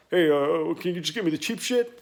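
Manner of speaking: mock the customer begging for cheap parts; a mocking take on a stingy customer; cheapskate customer. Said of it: "I get customers coming to my shop".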